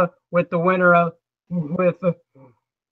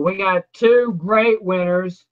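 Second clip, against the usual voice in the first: normal talking voice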